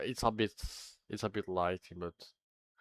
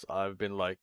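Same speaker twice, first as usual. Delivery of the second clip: usual speaking voice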